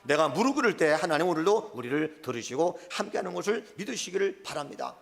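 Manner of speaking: usual speaking voice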